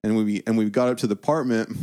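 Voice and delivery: ordinary delivery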